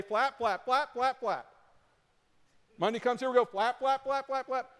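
high, loud, rapid-fire repetition; frantic flapping. Acted. Preacher mimicking Monday's return to striving, frantic and loud. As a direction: hammer it out like relentless busywork.

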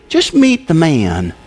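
slow, weighted meet the man; slow emphasis. Shown as a demonstration. Preacher urging the congregation, slow and weighty. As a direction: lean into each word slowly. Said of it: "read it through again slowly".

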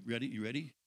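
normal talking voice

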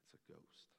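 whispered, hushed and eerie; spooky whisper. Retold or imitated. Preacher voicing the scared disciples, whispering. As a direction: breathe it like a frightened sailor.

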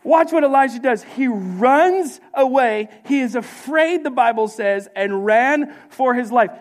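high, loud preacher's delivery; fervent preaching. Real, not acted. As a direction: preach it hard, stretching the key words. Preaches at raised force.

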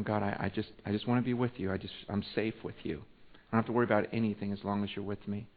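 low, soft, intimate plea; quiet prayer. Acted. Preacher confiding in God that he feels safe, quiet prayer. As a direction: pray it privately, barely projecting.

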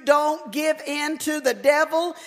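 loud, high, building preacher's cry; preaching at full force. Acted. Preacher warning the church about the devil, in a loud, building full-force sermon cry. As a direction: hammer it home from the pulpit.

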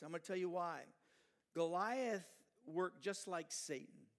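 loud, driving sermon delivery; fiery preaching. Acted. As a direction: preach it hard to the congregation. Preacher at full force on Goliath.

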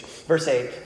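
ordinary talk, nothing marked

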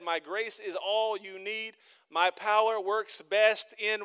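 slow, loud preaching of the verse; slow scripture reading. Acted. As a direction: lay each word down with weight. Quoting scripture slowly, preaching.

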